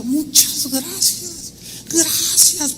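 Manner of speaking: slow, high, heartfelt thanks, imitated; deep gratitude. Young woman thanking the man who returned her wallet, grateful. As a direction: draw out each thank-you, high and earnest. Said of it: "pero con un agradecimiento".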